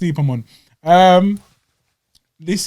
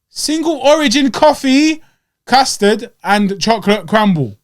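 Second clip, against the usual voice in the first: slow, much higher and louder listing; listing the full name; 6 semitones higher, 5 dB louder, slower than usual. Shown as a demonstration. Host mocking the long menu name, slow and high. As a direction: drag out every item sarcastically. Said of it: "am I gonna say all of that".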